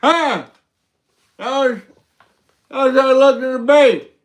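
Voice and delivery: lightly voiced Joe Biden losing the thread; mock Biden stumbling through a debate; Joe Biden impression. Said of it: "It's my Biden wig".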